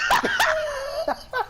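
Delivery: squealing laugh; high laugh. Real, not acted. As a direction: burst out laughing.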